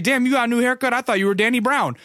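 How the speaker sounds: high-pitched retold amazement; high, acted-out surprise; amazed greeting. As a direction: imitate a friend marveling at someone.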